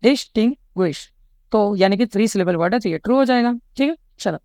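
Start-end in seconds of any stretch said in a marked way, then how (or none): 0.0-1.1 distinguish parted as dis, ting, guish; three separate syllables. Shown as a demonstration. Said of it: "तीन हिस्सों में तोड़ पा रहे हो".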